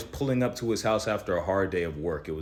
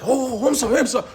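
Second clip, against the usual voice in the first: much higher, louder mock apology; embarrassed worker; 6 dB louder than usual. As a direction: squeal a sheepish sorry.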